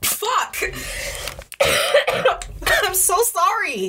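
high strained apology after choking; choking, straining. Real, not acted. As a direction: squeeze it out while coughing. Choking fit mid-sentence.

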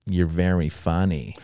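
low, slow, lightly voiced mafia boss; a hint of a don's quiet verdict. Club owner confiding in a young comic, hint of mafia boss. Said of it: "it's like a mafia situation".

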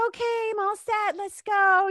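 high-pitched, carrying imitation of an oblivious customer; breezy mock customer. Customer leaving fitting room to boyfriend, mock breezy. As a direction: sing out high, mimicking her breezy exit.

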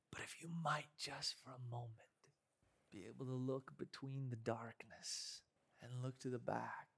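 whispering. Acted. Hushed turn in the sermon promo.

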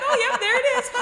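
high mock delight through a laugh; hammed-up cheery fib; fake cheerful act. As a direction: pretend you found it, laughing. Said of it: "I was like, I'm just gonna fake it".

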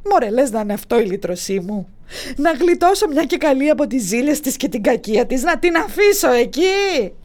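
altered voice, rising and slowing; tempted thinking aloud; a lightly voiced scheming Zeus; Zeus musing.

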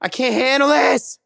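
high-pitched comic wail; mock meltdown. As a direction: cry out as if overwhelmed. Comic freak-out over lyrics.

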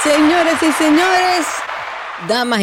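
high, slow showman's call; hyped giveaway announcement. Pretend. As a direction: announce it like a gala host. Mock award show announcement.

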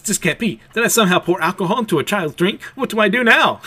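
put-on a mother panicking over a child, voice climbing; panicked. Yor fears she gave a child alcohol, rising panic. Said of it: "Yor is freaking out".

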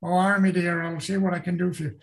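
put-on Cornish countryman's burr; Cornish accent. Shown as a demonstration. Author showing English countryside talk, Cornish accent. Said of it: "they talk the Cornishman talk".

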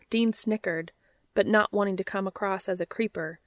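ordinary delivery, nothing marked

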